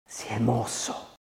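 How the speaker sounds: soft, slow and hushed; quiet, drawn-out confidence; low voice. A narrator's reading. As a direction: say it under your breath, slowly. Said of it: "disse ella a bassa voce".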